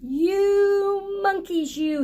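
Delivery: slow, high, sing-song scolding; scolding peddler. Acted. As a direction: wag a finger and drag out the words. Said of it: "he said, shaking a finger".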